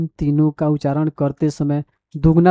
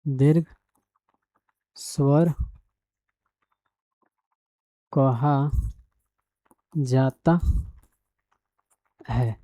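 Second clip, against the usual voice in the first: slow, word-by-word dictation, softer; 4 dB quieter, much slower than usual. Shown as a demonstration. Teacher dictating the definition to students, slow and measured. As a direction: spell it out slowly so students can copy.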